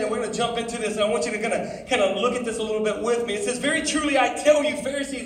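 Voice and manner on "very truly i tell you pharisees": lifted, louder preaching read of scripture. Acted. Preacher reading scripture to teens, louder and higher. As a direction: read the Bible out to the youth, rising.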